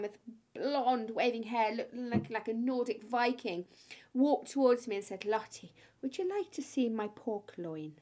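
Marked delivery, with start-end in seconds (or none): 5.2-7.9 slow mock Irish accent; terrible Irish accent. Young Irishman invites Lottie to his smokery, mock Irish accent. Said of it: "my terrible Irish accent".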